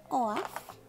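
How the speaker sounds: ordinary talk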